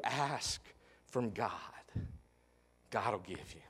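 soft, low, slow, halting plea; quiet grieving plea. Acted. Martha grieving before Jesus, soft and broken. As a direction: let each phrase fall away in grief. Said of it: "Martha said to Jesus".

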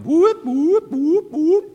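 high, loud mock alarm whoops; alarm siren. Preacher imitating the brain's alarm, high loud whoops. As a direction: blare it like a warning siren in the head. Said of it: "something in your brain going".

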